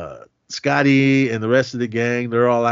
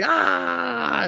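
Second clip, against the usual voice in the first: mock panicked scream. Crowd panicking at shootout.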